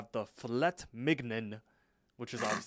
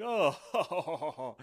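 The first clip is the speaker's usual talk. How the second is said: mock laughing oh, higher; a light hammed-up group chuckle; 4 semitones higher, 3 dB louder than usual. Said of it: "they're all laughing like".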